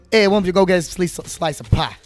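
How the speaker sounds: hammed-up New Jersey pitchman; bad New Jersey accent. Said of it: "worst New Jersey accent ever".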